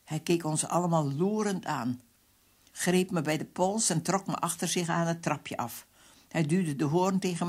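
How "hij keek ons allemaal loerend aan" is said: ordinary delivery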